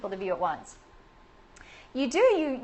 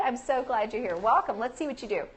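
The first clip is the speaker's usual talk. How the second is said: ordinary delivery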